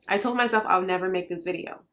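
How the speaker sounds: ordinary talk, nothing marked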